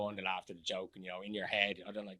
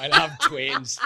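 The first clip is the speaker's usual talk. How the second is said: laughing; 13 semitones higher, 9 dB louder, much slower than usual. Real, not acted. Joking about having twins.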